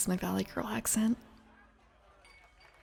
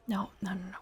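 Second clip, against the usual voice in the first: soft whisper; 6 dB quieter, faster than usual. Real, not acted. Whispering in ASMR style.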